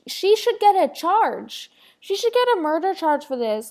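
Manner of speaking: usual speaking voice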